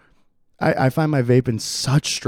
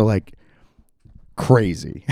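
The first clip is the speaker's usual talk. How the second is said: usual speaking voice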